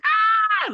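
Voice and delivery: loud, very high panicked scream; a trapped scream of losing it. Retold or imitated. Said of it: "losing it".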